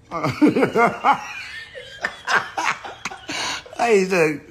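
breaks into giggles mid-sentence; laughing while trying to talk. Real, not acted. Livestreamer on Instagram, laughing at himself.

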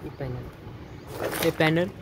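usual speaking voice

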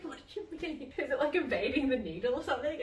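incredulous. Retold or imitated. Acts out incredulous reply about vein.

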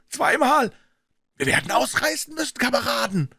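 high, strained warning; alarmed call. A narrator's reading. Gang member to comrades, alarmed call. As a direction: urge your mates to run.

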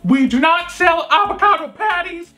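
mock shouted announcement; imitating a screaming worker. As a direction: belt it like a fed-up worker shouting to the crowd. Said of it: "start screaming".

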